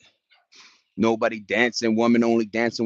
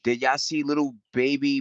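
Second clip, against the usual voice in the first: ordinary talk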